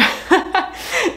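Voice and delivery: a short, high, airy giggle; high breathy laugh. Real, not acted. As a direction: laugh at yourself, lightly embarrassed. Laughs at getting too deep.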